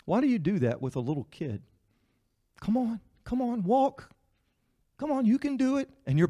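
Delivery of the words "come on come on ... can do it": high, sing-song coaxing parent; coaxing a toddler. Retold or imitated. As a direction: coax a toddler to walk.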